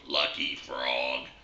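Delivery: talking frog. Acted. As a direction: flatly repeat it back in a low frog voice. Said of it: "He goes".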